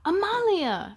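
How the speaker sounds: mild mock rebuke from a military dad; scolding father. Stern father calling his twirling daughter, mildly scolding. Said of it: "scolded her father".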